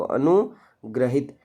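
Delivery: अनुग्रहित drawn out very slowly, syllable by syllable; slow careful pronunciation. Shown as a demonstration. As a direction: spell out अनुग्रहित slowly for students. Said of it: "इस प्रकार से लिखा जाता है अनुग्रहित को". (अनुग्रहित को धीरे-धीरे साफ़ बोलें)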